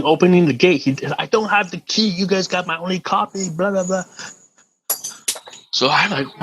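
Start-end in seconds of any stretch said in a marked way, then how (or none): 1.2-4.0 retold screaming. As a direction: imitate a landlord ranting at the gate. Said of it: "This guy's screaming".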